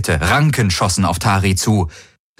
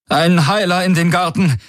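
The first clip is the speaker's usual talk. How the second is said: calling out with a shaking voice, higher; trembling call; 10 semitones higher than usual. Acted. Ylana calling into her comm for a healer, voice trembling. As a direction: shout for help while shaken. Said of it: "rief sie mit zitternder Stimme".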